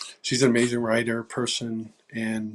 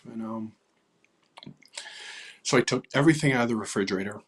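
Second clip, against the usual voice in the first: ordinary talk, nothing marked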